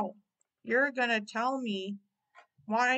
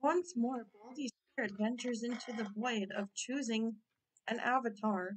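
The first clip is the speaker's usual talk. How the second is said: dramatic narration; 6 dB quieter than usual. Acted. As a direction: intone it like a mystical narrator. Narrating avatar change.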